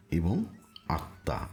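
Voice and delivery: ordinary talk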